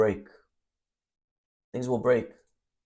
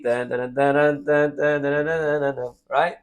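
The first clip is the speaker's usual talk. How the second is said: band music; 3 semitones higher than usual. Retold or imitated. Imitates band starting to play.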